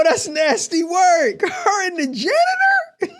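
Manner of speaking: slow, drawn-out words breaking into laughter; scandalized laughing. Real, not acted. Listener hearing the rumor about the janitor, scandalized and laughing. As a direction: react in laughing disbelief, stretching it out.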